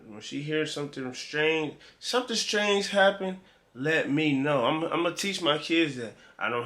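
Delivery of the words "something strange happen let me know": put-on higher, insistent advice to a child; parental instruction. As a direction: pretend parent laying down a rule.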